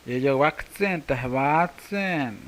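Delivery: slow, drawn-out Yeyehuatzen, tehuatzen; Yeyehuatzen, tehuatzen said the slow local way; local variant greeting. Shown as a demonstration. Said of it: "con las variantes que existen".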